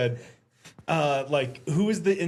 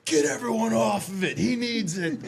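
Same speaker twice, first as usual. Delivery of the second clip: high, commanding mock shout; bossy nurse; 5 semitones higher than usual. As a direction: clear the bed like an urgent nurse. Nurse lady ordering people away.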